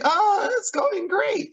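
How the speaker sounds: high, drawn-out mock breeziness; fake-cheerful lying. Rory lying to the headmaster, mock fake-cheerful. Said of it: "in her normal lying way".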